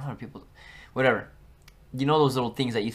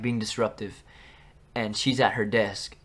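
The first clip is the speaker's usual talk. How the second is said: ordinary talk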